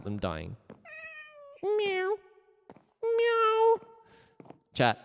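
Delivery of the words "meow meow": high drawn-out cat meows; squeaky-high imitation cat. Shown as a demonstration. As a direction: meow like a little cat, way up high.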